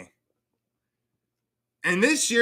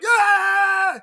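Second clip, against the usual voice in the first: short shriek pitched far up and louder; startled scream; 6 dB louder than usual. Retold or imitated. Streamer acting out opening the freezer, high shocked scream. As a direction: yelp like you just found a dead dog in the freezer. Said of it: "And you're like".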